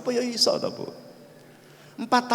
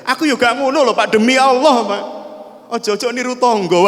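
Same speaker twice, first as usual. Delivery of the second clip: loud, higher indignant retort; protesting wife; 6 semitones higher, 6 dB louder than usual. Acted. Wife disowns child's fault to husband, loud protesting retort. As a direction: protest hard, swearing it to the husband. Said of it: "Istrinya juga bilang".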